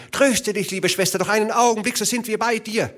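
high, hurried reassuring call; brother calling. A narrator's reading. Youngest brother calling to his sister from afar, urgent reassurance. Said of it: "dass sie seine Stimme hörte".

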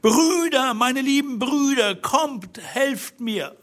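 desperate cry for her brothers; calling for help. A narrator's reading. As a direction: call out to distant brothers at full pitch. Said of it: "rief, so laut sie konnte".

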